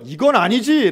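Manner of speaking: loud, high indignant cry; angry protest. Retold or imitated. Elder son crying out against his father's unfairness. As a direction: shout it as a flat refusal.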